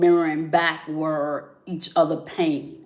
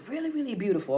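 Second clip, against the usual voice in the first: higher and softer; 6 semitones higher, 5 dB quieter, much faster than usual. Real, not acted. Wistful repeat of really beautiful.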